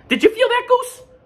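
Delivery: very high and loud; excited Duck. Acted. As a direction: gasp it out like a thrilled duck. Duck feels egg move.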